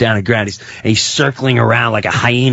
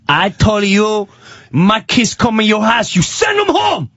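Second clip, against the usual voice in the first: yelling; 9 semitones higher than usual. Retold or imitated. Pizza man orders his kids sent home, rising high-pitched yelling. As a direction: imitate an angry neighbor, pitch climbing to a shout. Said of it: "stop yelling".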